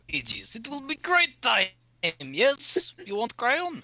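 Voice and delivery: put-on high, hammy Russian-accented warrior; big Russian accent, booming and proud. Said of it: "you do not have to do the Russian accent".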